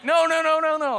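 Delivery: high, loud run of protests; frantic denial. Acted. Preacher reassuring his alarmed friend on the phone, frantic denial. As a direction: fire it off high, easing down at the end. Acted hurried denial of a wreck.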